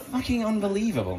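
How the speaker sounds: ordinary delivery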